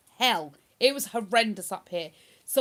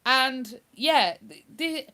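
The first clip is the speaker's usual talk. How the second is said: ordinary delivery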